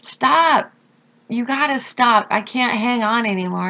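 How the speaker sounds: pleading mock shout to stop; retold yell. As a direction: call out to Lurch to stop the ride. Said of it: "like, yelling to Lurch".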